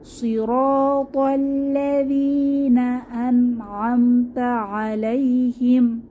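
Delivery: slow chanted verse; tajweed reading; Quran recitation. Shown as a demonstration. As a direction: recite slowly to close the verse. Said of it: "কিভাবে পড়ব".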